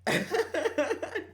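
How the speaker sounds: high burst of giggles; high, loud giggle; laughing. Real, not acted. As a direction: crack up laughing. Laughs after the warning.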